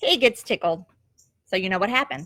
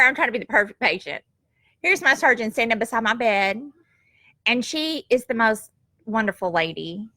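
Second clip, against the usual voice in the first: ordinary delivery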